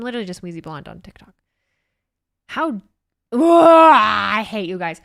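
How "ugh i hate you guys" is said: loud, drawn-out groaning yell; exasperated yell. Real, not acted. Exasperated outburst at chat.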